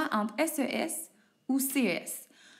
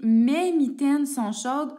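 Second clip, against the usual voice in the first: emphatic example, louder; 4 semitones higher, 6 dB louder, slower than usual. Shown as a demonstration. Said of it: "Donc, j'essaie".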